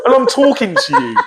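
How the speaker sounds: ordinary talk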